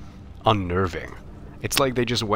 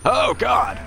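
cringing shout; 5 dB louder than usual. Real, not acted. Cringing at own teen self.

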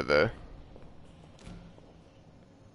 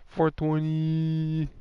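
slow, higher, drawn-out joke; stoner joke drawl; 7 semitones higher, much slower than usual. Acted. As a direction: savor the number slowly. Joking about the timer.

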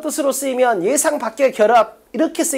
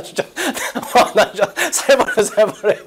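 laughing in disbelief. Real, not acted. Teacher amazed by a student's question, laughing. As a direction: talk through real laughter.

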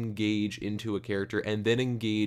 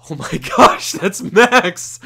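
much louder, higher squeal of delight; thrilled recognition; 8 semitones higher, 12 dB louder, slower than usual. Retold or imitated. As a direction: shriek it like you just recognized a favorite character. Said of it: "I'm like".

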